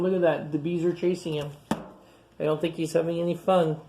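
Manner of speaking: ordinary delivery, nothing marked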